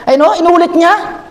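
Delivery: normal talking voice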